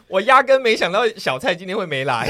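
laughing through a joke. Real, not acted. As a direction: joke while laughing, loud and high. Host jokes, laughing.

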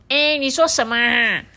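high, loud puzzled raccoon. A narrator's reading. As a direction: ask like a raccoon who missed it. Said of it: "心不在焉浣熊大多时候只会说".

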